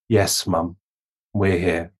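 low, distant lightly voiced son; a hint of the son answering from beyond; dead son's voice. Son answering his mother, lightly voiced from far off. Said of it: "in Howard's voice".

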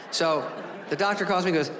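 ordinary talk

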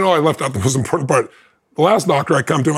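ordinary talk, nothing marked

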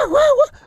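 very high yelping barks; imitated dog barking. Retelling how the dog barked until it went hoarse, very high yelping barks. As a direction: yelp it way up like a desperate dog.

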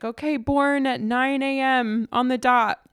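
high-pitched imitation of doctor announcing a birth; calling out time. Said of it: "they call out his birth time".

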